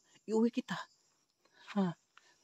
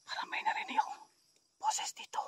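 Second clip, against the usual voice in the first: whisper; 8 dB quieter than usual. Real, not acted. Ghost hunt, hears a voice.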